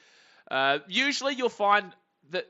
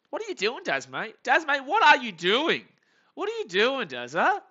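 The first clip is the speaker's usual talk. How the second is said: raised, incredulous questioning; exasperated scolding; 6 semitones higher, 4 dB louder than usual. Real, not acted. Fan scolding Dazza through the screen, exasperated. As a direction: tell off the runner in disbelief.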